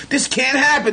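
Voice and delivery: retold panic, pitch leaping up; alarmed disbelief. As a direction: cry it out, appalled. Retold disbelief, escalating second take.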